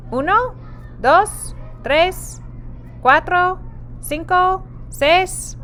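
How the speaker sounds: put-on counting up high and lively for children; bright counting. Shown as a demonstration. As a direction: count each number up high, cheerfully. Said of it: "counting chickens aloud with kids".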